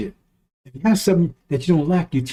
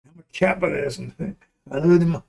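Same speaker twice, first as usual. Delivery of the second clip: mocking voice. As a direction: fawn over them mockingly. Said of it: "Why you talking like that?".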